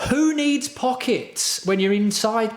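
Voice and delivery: high, scornful mock voice, drawn out slowly. Streamer ridiculing the insider jersey, high mocking voice. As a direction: sneer at the idea in a squeaky voice.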